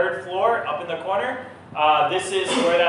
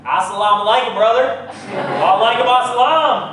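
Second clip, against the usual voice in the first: hillbilly accent; 6 semitones higher, 7 dB louder than usual. Shown as a demonstration. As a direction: say it like a Florida hillbilly. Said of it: "You sound like a hillbilly".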